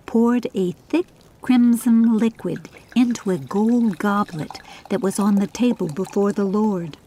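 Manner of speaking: ordinary delivery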